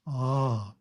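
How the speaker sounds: Ah held out as a long open vowel; Ah drawn out for two seconds; prolonged ah. Shown as a demonstration. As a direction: stretch Ah long like a chant. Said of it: "but prolonged".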